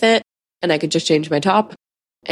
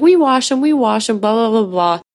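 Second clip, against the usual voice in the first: mocking principal; 5 semitones higher than usual. Teen mimicking the principal's excuse to mom, mocking and dismissive. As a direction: parrot her excuse like it means nothing.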